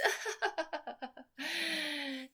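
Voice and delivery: high mocking cackle; mocking laugh. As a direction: imitate her sneering laugh. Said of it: "She laughs".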